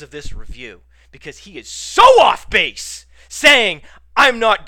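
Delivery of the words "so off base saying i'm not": loud, high-pitched indignant cry; indignant outburst. Real, not acted. Podcaster rebutting a bad review, indignant outburst. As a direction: protest at full volume.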